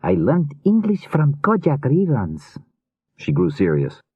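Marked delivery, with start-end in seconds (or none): 0.0-2.6 put-on high, slow comic Slavic accent; thick Slavic accent. A narrator's reading. Rune jokes with the cop, thick Slavic accent. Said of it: "In a thick Slavic accent".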